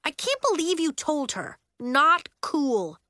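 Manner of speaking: reproachful. Acted. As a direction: make him feel it. Nori reproaching Elliot.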